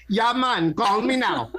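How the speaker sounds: high, loud hammed-up Jamaican accent; fake Jamaican accent. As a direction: Jamaican psychic hotline accent. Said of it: "a fake Jamaican accent".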